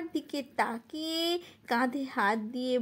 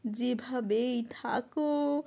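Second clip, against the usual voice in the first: normal talking voice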